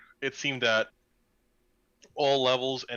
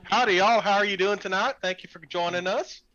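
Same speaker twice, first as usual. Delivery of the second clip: a hint of a southern accent; 7 semitones higher, 5 dB louder than usual. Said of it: "I totally already lost my southern accent".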